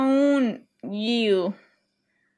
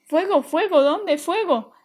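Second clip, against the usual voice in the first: high, loud startled questions; jolted awake and frightened; panicked questions; 6 semitones higher, 5 dB louder than usual. Acted. Mom woken by shouts of fire, panicked.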